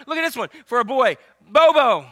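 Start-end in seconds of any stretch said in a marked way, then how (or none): 1.5-2.1 loud announcement. Pretend. Reading out funny baby names.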